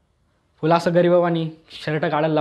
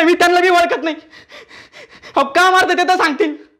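high, loud protests under a beating; re-enacted yelping plea to the policeman; pained pleading; 13 semitones higher, 5 dB louder than usual. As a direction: plead loudly as blows land, pitch way up. Acts out pleading while being beaten.

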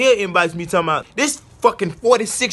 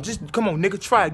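usual speaking voice

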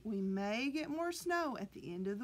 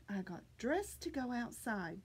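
usual speaking voice

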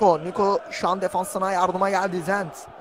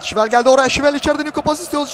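loud, raised burst of commentary; higher, louder match call; excited play-by-play; 7 semitones higher, 7 dB louder than usual. Real, not acted. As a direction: punch up the call as the attack arrives. Attack in live match commentary.

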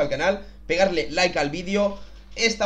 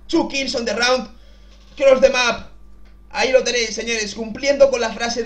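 higher, louder triumphant call; excited announcing; 5 semitones higher, 5 dB louder, slower than usual. Real, not acted. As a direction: announce the win with pride. Celebrating the clutch play.

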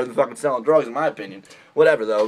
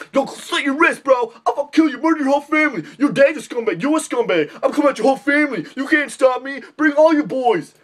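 much higher, hammed-up threats; mock tough guy threats; 9 semitones higher than usual. Mocking an angry internet tough guy, high threats. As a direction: rant like a cartoon thug. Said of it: "You're like".

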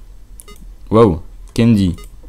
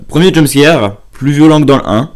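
excited; 6 semitones higher, 5 dB louder than usual. Real, not acted. Reacting to jumpscare.

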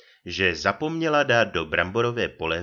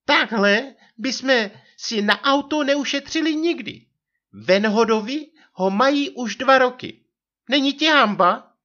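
very high, loud scolding wife; hubující manželka; 6 dB louder than usual. A narrator's reading. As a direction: shame him in a put-on high voice. Narrator voices scolding wife. (hubující vysoký hlas manželky)

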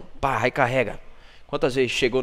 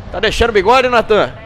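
higher, louder teasing question; teasing call-out; 6 semitones higher, 7 dB louder than usual. Real, not acted. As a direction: rib Natan loudly, high. Repete a provocação a Natan.

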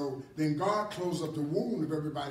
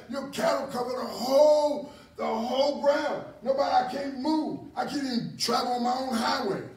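high, loud indignant complaint; indignant king; 6 semitones higher, 3 dB louder than usual. Acted. Abimelech ranting at Abraham over cattle, loud and indignant. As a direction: rant like an angry king whose land is overrun.

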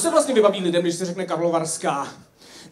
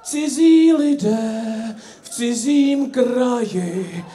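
recited verse; 3 semitones higher, 5 dB louder, slower than usual. Retold or imitated. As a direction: declaim it slowly like a lofty poem. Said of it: "tak nám napsal Zdeněk taky verše". (pomalý, hlasitější a vyšší přednes verše)

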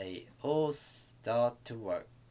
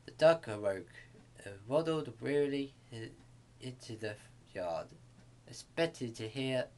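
ordinary delivery

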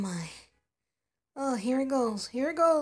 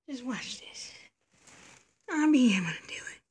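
whisper; 2 semitones lower than usual. Acted. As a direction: whisper to yourself before the big effort. Whispering while trying the drawer.

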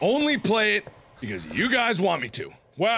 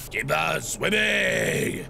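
lowered silly voice; 4 semitones lower, much slower than usual. Acted. Repeating a game instruction.

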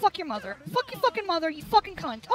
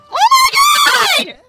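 long, high, slow shriek; exasperated scream; 9 dB louder, much slower than usual. Real, not acted. Player taking hit after hit, drawn-out high scream. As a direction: howl it out in pure frustration. Being hit repeatedly in game.

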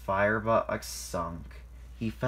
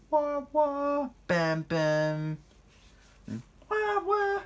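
high-pitched toy-train toots; playful mimicked train sounds; imitated train horn; much slower than usual. A narrator's reading. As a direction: sing out horn and bump noises. Narrator imitates horn and engine noises.

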